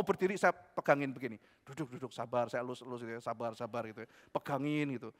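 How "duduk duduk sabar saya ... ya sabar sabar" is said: plus doux et plus bas, calmant; apaisant. Retold or imitated. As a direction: calmer un ami qui s'emporte. Menenangkan teman yang emosi.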